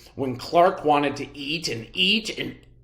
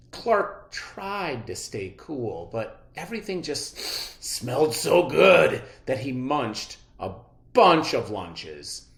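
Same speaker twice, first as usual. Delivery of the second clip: plain reading voice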